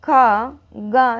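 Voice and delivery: खा and गा stretched slowly from the throat; drawn-out syllables. Shown as a demonstration. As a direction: hold each syllable, खा... गा. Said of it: "आप बोलिए का".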